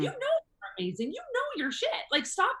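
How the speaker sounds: high-pitched insistent pep talk to yourself; high, emphatic inner cheerleader. Acted. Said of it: "my inner being's like".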